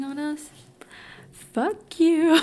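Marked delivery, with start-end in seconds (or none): 1.3-2.3 loud, higher, playful kiss-off; cheeky mock defiance; gleeful insult. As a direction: sing out the insult, breaking into laughter. Mock message to the haters.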